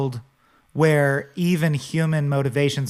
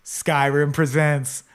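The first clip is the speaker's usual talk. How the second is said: mock trailer voice. Mock game presentation title.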